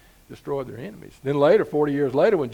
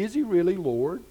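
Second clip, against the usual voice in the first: normal talking voice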